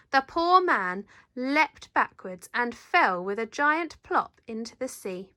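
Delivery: ordinary delivery, nothing marked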